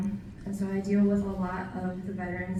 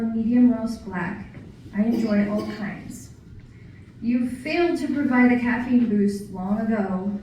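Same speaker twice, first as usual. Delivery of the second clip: measured, projected poem recital in short phrases; poetry reading; 7 dB louder than usual. Acted. Poet sharing a lighthearted coffee poem with an audience, poetry reading. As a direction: recite it line by line, louder than chat.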